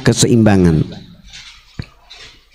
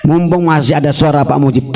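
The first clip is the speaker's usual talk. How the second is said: ordinary talk, nothing marked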